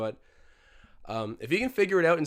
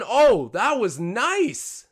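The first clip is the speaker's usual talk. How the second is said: excited; 6 semitones higher, 5 dB louder, much slower than usual. Real, not acted. Reacting live to a play.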